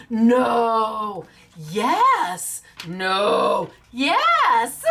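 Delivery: loud flip-flopping shout; imitating giddy winner. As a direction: act out a winner who can't believe it. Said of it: "jumping up and down and saying".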